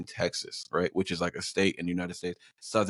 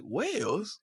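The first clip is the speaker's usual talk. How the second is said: put-on high London-accented echo, rising sharply; high mock London query; imitated incredulous Londoner; 12 semitones higher, 3 dB quieter than usual. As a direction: mimic a Londoner's disbelieving question. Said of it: "He was like".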